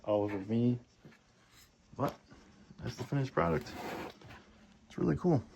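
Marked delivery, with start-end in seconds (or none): none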